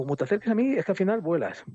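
retold angry warning. Retold warning after a shove. (advertencia enfadada imitada, más aguda)

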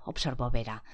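usual speaking voice

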